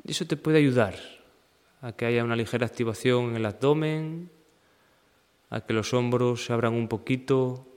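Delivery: even, unhurried meditation guide; guided meditation. Acted. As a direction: guide them through the body in level phrases with long pauses. Leads a seated meditation practice. (meditación guiada, pausada y serena)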